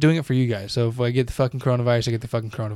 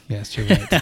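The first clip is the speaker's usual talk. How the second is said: stifled giggles; 3 semitones lower than usual. Real, not acted. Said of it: "I can't even keep a straight face".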